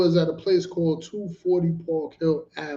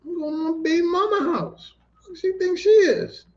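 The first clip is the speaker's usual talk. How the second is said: much higher, incredulous outrage; indignant disbelief; 12 semitones higher than usual. Retold or imitated. Narrator objects the woman can't come home, high indignant disbelief. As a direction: squeak out the protest in disbelief. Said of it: "And I'm like".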